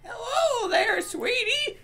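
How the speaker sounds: mock sweet old lady greeting; lightly voiced granny calling them sweetie; creepy old lady. Mimicking an old lady's sweet greeting. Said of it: "Like, who says that?".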